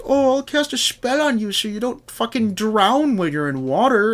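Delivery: high, lightly put-on sorceress voice; high mock quest-giver voice. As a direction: toss off the spell offer in a high mock voice. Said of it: "she's like".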